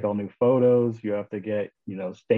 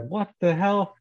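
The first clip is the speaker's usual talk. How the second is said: retold outburst, higher-pitched and incredulous; shocked disbelief; 10 semitones higher, slower than usual. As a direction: squeak out the shock at the news. Cops called on his house.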